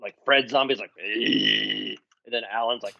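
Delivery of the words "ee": zombie groan. Retold or imitated. Imitating zombie Fred.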